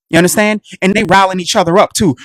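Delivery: ordinary delivery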